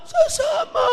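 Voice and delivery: high shrill shout; mock wailing sister. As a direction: shriek it like the grieving sister. Sister answers uncle. (pabirong matinis na sigaw)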